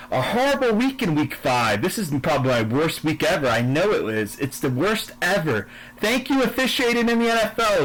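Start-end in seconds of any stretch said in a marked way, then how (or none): 6.0-7.8 sarcastic thanks. Real, not acted. Sarcastic thanks to NFL referees.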